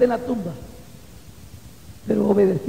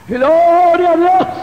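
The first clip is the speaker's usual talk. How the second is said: drawn-out, high, loud praise cry; grito de alabanza; 6 semitones higher, 7 dB louder, much slower than usual. Real, not acted. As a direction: shout praise, stretching the first word. Cry of praise after quiet build. (grito de alabanza agudo y alargado)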